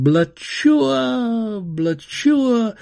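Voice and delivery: high, drawn-out firework burst imitation; exploding fireworks. A narrator's reading. Carnival fireworks exploding, loud high imitated bursts. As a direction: burst out loud and high, then let it fall away. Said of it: "Carnival fireworks exploded".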